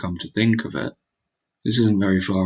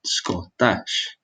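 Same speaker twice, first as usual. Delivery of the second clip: ordinary talk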